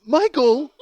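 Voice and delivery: wife's alarmed call. Retold or imitated. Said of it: "And Karen goes".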